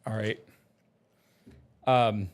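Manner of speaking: ordinary delivery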